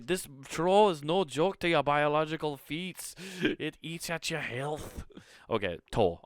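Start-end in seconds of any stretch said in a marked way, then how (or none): 0.1-5.0 high, hammed-up Scottish warning; drawn-out comic Scottish ending; mock Scottish accent. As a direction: warn of the troll in a put-on Scottish voice. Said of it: "I'll stop with the fucking Scottish accent".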